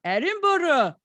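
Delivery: "Edinburgh" said "ED-in-bor-uh"; careful try at "Edinburgh", all four syllables. Shown as a demonstration. Testing her own "Edinburgh" before playing the clip, careful and spelled out. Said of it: "the pronunciation of Edinburgh".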